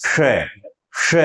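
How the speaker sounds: श said with the tongue tip curled back toward the roof; retroflex sh. Shown as a demonstration. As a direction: hold a hushed retroflex श. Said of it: "श का उच्चारण करना है".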